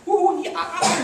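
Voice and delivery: high laughing chimp whoop; chimp party whoop. Retold or imitated. Lecturer voicing partying chimps with a whoop.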